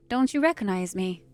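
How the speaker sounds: ordinary delivery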